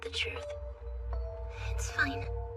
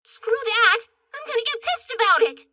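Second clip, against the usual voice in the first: high, loud angry outburst; defiant anger; 7 semitones higher, 5 dB louder than usual. Acted. Rejecting sadness to get pissed instead, defiant anger. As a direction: fire yourself up into anger.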